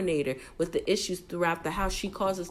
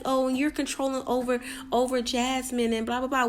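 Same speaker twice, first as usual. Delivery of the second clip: higher mocking whine; mocking housemate voice; 7 semitones higher than usual. Recapper imitates the houseguest complaining about Jasmine, mocking whine. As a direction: parody her, dismissive and whiny. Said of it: "she's like".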